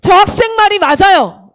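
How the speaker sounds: loud, projected imitation of a preacher; acted pulpit outburst; pastor's emphatic agreement. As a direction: declare it to the whole congregation. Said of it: "목사님이 그 순간 저를 향해서".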